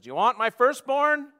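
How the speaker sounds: loud, high, exasperated question; exasperated worshiper. Acted. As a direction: throw the offer out in frustration. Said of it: "the exasperated worshiper says".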